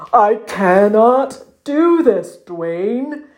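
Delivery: slow, dragged-out wail of a muskox; anguished cry. A narrator's reading. Muskox stuck on river rocks to his friend, crying out. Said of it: "he cried from atop the two river rocks".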